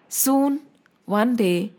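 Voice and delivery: ordinary delivery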